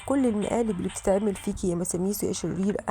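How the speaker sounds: ordinary delivery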